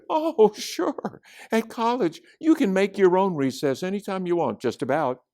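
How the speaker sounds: high, quick, assured voice; Chloe, breezy college student. A narrator's reading. Chloe answers a kid about college, high and breezy.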